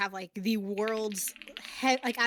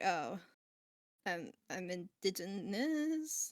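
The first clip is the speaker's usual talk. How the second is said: softer, slow and halting mock answer; awkward hesitant reply; 6 dB quieter, slower than usual. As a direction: act out a reluctant, unsure admission. Acting their own awkward reply.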